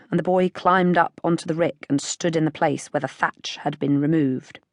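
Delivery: plain reading voice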